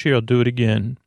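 normal talking voice

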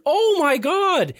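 high, drawn-out gasp of horror; slow, high-pitched astonishment; shocked exclamation. Retold or imitated. Friend's shocked exclamation, retold.

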